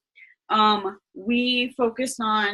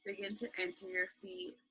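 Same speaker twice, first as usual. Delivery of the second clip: guided meditation; 12 dB quieter than usual. Acted. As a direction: ease them into calm, right in your ear.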